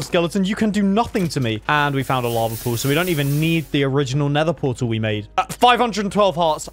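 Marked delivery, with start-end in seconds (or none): none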